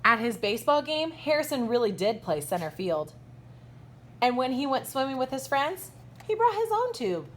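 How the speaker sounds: lively, bright storybook read-aloud with big swoops; reading to children. A narrator's reading. Teacher reading a picture book to her kindergartners, animated storybook voice. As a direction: read it like story time for little kids.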